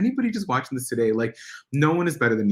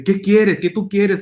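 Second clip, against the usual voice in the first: imitating mom, raised, higher and louder; mom's angry outburst; 8 semitones higher, 8 dB louder than usual. Mother angrily confronting her child, imitated loud and high. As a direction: snap back at your kid like an angry mother. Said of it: "she was just so mad".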